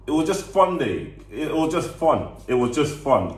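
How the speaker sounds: excited. Real, not acted. Recalling carefree week as child.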